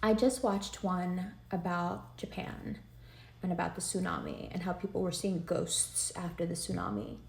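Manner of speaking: quiet, choked-up voice; fighting back tears; holding back tears. Real, not acted. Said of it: "I'm gonna try not to cry".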